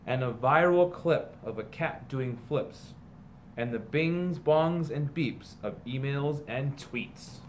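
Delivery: slow, sing-song picture book read-aloud; storybook reading. A narrator's reading. Teacher reading a parody picture book to students, storybook voice. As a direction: read it like a bedtime story to the class.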